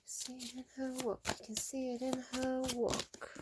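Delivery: hushed. Acted. Reader murmuring a song line over the cards. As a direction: breathe it out softly and slowly.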